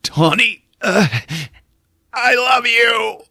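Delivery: hammed-up desperate love; mock heartfelt plea. Jim pleading with girlfriend, hammed-up I love you. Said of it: "And he's like".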